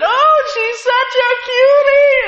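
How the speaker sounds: high, slow, sugary falsetto; cooing woman. Retold or imitated. Preacher imitating the wife cooing over his daughter in high falsetto. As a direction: gush over the child in a sweet high voice.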